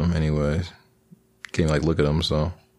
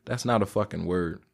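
ordinary delivery

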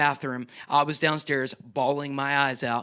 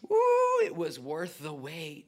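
delighted ooh; 4 dB quieter, slower than usual. Retold or imitated. Believer tasting the long-awaited answer, ooh then soft. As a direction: savor it like a lifted lid. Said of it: "you're gonna go".

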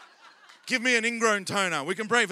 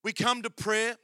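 ordinary delivery

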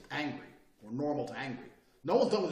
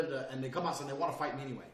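ordinary delivery

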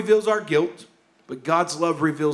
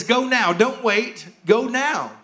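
urgent call to leave now; 7 semitones higher than usual. Retold or imitated. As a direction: urge her out the door right now. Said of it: "There's a sense of urgency to this".

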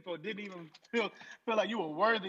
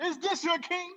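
higher, laughing mock call; mocking announcer; 10 semitones higher, 3 dB louder, slower than usual. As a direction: jeer at the crowd. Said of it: "This is a man who challenged [name]".

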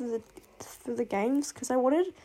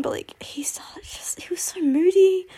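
exasperated whisper; 7 semitones higher, 4 dB louder than usual. Real, not acted. Exasperated aside about character.

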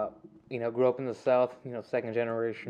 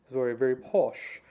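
lightly put-on posh English accent; faster than usual. Joking about parents' proper English, in a posh accent. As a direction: say it with mock British refinement.

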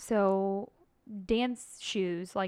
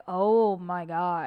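drawn-out shocked oh my God; 3 semitones lower, 5 dB louder, much slower than usual. Retold or imitated. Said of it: "I was like".

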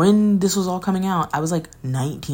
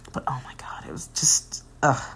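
whispered, much softer, worn out; exasperated whisper; 3 dB quieter than usual. Real, not acted. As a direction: let it fall out in a weary hush. Groaning at the memory.

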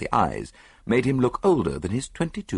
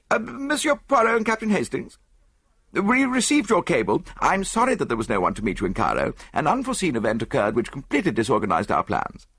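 a higher-pitched voice for the British archaeologist; eager expedition Englishman; 6 semitones higher than usual. A narrator's reading. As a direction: greet them brightly as the expert. Voiced expedition man greets guests.

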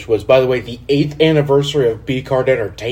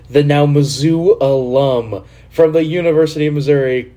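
ordinary talk

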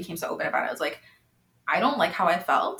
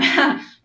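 1 teary laugh; 8 semitones higher, 8 dB louder than usual. Real, not acted. Embarrassed laugh through tears.

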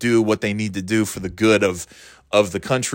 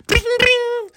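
very high ringing "bing, bing"; bicycle bell imitation. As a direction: squeal out two little bell dings. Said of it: "he has a little bell on his bike".

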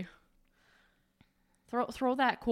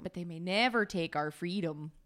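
put-on Midwestern accent; 4 semitones lower than usual. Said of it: "accent is Midwestern".

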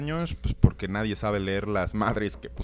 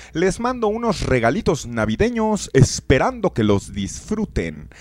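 lectura de carta; 4 semitones higher, 6 dB louder than usual. Acted. Lee la carta de un oyente.

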